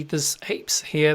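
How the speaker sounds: ordinary talk, nothing marked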